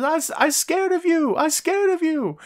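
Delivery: very high comic scaredy-cat voice; Toru Yano. Retold or imitated. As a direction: plead in a squeaky mock-frightened voice. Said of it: "He says".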